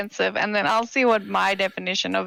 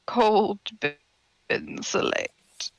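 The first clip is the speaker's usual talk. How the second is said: slow savoring; much slower than usual. Real, not acted. Repeating the whiskey name slowly.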